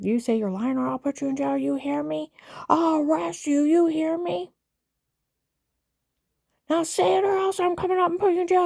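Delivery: high, loud furious yell, building as it goes; screaming agent. Retold or imitated. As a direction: scream the threats at her like an enraged agent. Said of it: "he starts screaming".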